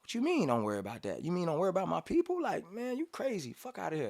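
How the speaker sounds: incredulous retort. Retold or imitated. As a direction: voice climbing high and fast in disbelief. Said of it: "Like, that was my energy".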